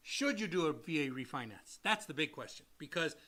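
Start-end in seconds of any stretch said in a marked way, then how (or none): none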